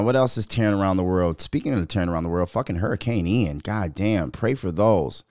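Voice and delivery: ordinary talk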